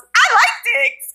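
high, drawn-out squeal imitating a friend; mimicked gleeful interjection; mock blurted outburst. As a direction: pop up and blurt it out, high and slow. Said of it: "Megan pops up and goes".

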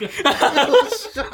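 high-pitched, drawn-out cheer of triumph; 勝利の歓声. Real, not acted. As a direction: celebrate the win with a high, long yell. 正解して勝ちを喜ぶ. (甲高く伸ばして喜ぶ)

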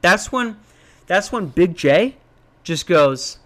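ordinary talk

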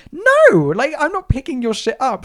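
high, loud burst of outrage; hammed-up indignant protest, starting with a yelp; indignant refusal. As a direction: dramatically put your foot down. Acted refusal to a scammer.

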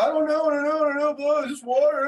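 high, babbling imitation of a child's denial; mock panicked protest. Scolded son denies knowing about the water, mock panicked protest. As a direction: imitate a flustered kid pleading ignorance.

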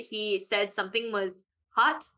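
ordinary delivery

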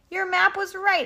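high, loud happy sheep voice; delighted cheering. A narrator's reading. Sheep reassure Henry about his map, delighted. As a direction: exclaim it high, easing at the end.

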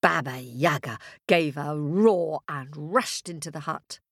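plain reading voice

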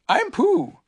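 small gentle bear; Pooh voice. A narrator's reading.

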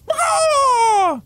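piercing high loud shriek; dragon shriek. Acted. Drogon answers with a shrieking loud cry. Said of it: "said Drogon".

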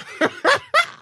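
high shrieking laugh; high burst of laughter. Real, not acted. Laughing at being exposed.

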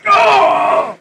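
high, loud acted cry of pain; pained yelp. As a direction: yelp as if your foot was stomped. Guard reacts to stomped foot.

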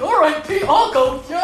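loud goofy cartoon agreement; hammed-up cartoon pushover. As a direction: go along like a dopey cartoon dog.

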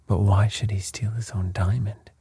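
ordinary delivery

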